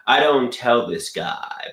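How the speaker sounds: ordinary delivery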